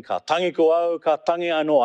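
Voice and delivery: a mild, choked mourning cry; choking lament. Retold or imitated. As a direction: wail it as if nearly choking. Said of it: "I howled, nearly choking".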